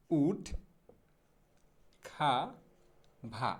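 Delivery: উড, খা, ভা each alone with long pauses between; syllable-by-syllable demo. Shown as a demonstration. Teacher breaking down woodcarver, উড খা ভা piece by piece. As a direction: separate "wood ... kha ... va", no r sounds. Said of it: "সঠিক উচ্চারণটা হবে হচ্ছে".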